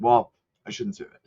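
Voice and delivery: ordinary talk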